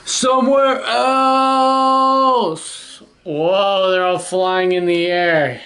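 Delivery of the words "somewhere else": high, long drawn-out wail; exasperated elephant cry. Acted. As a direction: stretch the last word into a pleading howl. Elephant cries out, fed up.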